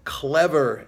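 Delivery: drawn-out, mocking emphasis; sarcastic. Real, not acted. Analyst mocking the supposedly clever investors, sarcastic. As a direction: stretch it out with sarcasm. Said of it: "clever, I'm being sarcastic".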